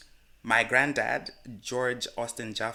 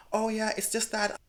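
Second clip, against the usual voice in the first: higher-pitched mock excuse-maker; lightly put-on voice, higher than your own; imitated excuse; 8 semitones higher, 4 dB quieter than usual. Imitating a friend's passport excuse, mock explaining.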